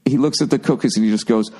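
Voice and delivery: normal talking voice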